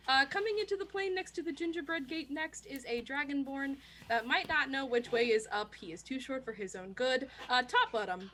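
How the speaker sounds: normal talking voice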